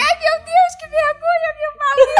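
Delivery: high, loud mock embarrassment; mortified mother. Mother embarrassed by dad's English, high mock wail. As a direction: squeal it like a hammed-up mortified mom.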